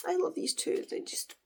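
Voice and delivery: soft, breathy sigh of affection; sighing fondness. Real, not acted. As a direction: sigh it out with tender longing. Sighing fondly about the couple.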